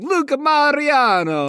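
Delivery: high, slow, over-the-top Italian accent; exaggerated Italian accent. Shown as a demonstration. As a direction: say it like a proud Italian. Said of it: "the most Italian accent possible".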